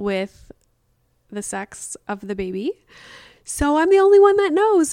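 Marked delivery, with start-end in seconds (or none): 3.4-4.9 high, loud gleeful boast; excited. Real, not acted. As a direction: brag about it with glee. Proud secret-keeper.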